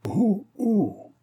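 high "oh, oh!" sliding down; startled exclamation on varied tones. A narrator's reading. Brother balks at talk of marriage, startled high exclamation. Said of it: "sur trois tons différents".

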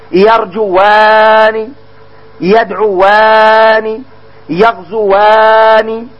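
each dual verb يكتبان يضربان stretched slowly, ending ان drawn out; slow model reading. Shown as a demonstration. Teacher drilling dual verbs, each stretched slowly. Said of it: "ألف الاثنين".

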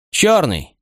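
high, stark call of the lot; announced verdict. A narrator's reading. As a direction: call out the doom.

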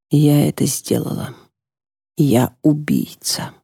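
slow, soft, hollow self-blame; broken whisper. Acted. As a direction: say it slowly, crushed by guilt. Widow rocked, blaming herself.

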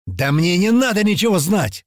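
high-pitched, defiant outburst; heated retort. Acted. Young man rejecting the girl's rebuke, heated retort. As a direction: throw it back at her angrily. Hothead shouts back in quarrel.

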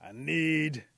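loud fed-up outburst, slowing at the end; exasperated Eagle. A narrator's reading. Eagle hears singing and summons Panther, loud exasperation. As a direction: build the complaint, then stretch out the summons.

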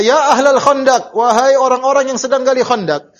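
loud, high-pitched call to a crowd; imitating a rallying shout; retold shout. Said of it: "Nabi teriak".

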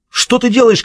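high, startled cry; shouted alarm. A narrator's reading. Guard sees the sudden attack, shouted alarm. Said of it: "крикнул охранник".

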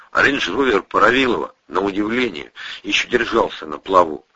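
usual speaking voice

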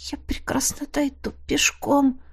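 breathless whispered protest; whispered stubborn reply; weak whisper. Acted. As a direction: insist in a faint whisper. Weak heroine after drowning.

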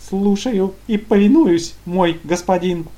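high, loud, slow delighted gurgle; gleeful gurgling. Acted. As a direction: gurgle it out with servile glee. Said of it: "восторженно пробулькал".

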